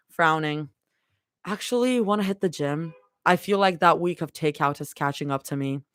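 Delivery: low, steady read-aloud of a fanfic chapter; story narration. A narrator's reading. Streamer reading a fanfic chapter to chat, low and steady. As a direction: read it like a cozy audiobook.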